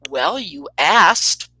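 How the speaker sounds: slow, drawn-out retort; pause, then stretch out the punchline; deadpan comeback. Retold or imitated. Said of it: "so I pause, and I go".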